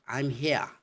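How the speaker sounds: "here" with silent R, as "hee-uh"; British HERE. Shown as a demonstration. Answering someone who is searching, British non-rhotic "here". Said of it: "the way you say it in British".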